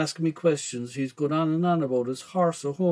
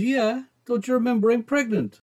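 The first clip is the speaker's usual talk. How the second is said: high-pitched mam voice; raised motherly voice; mother's reply; 7 semitones higher than usual. A narrator's reading. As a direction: remind your son as the mother, pitched up.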